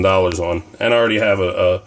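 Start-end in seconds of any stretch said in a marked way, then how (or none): none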